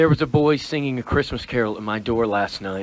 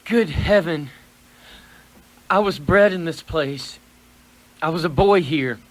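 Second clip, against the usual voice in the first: high, loud, overcome with memory; moved, trembling; 7 semitones higher, 4 dB louder than usual. Acted. Old man sees the place where he grew up, trembling with emotion. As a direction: voice rising as old memories flood in. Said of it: "Your lip is trembling".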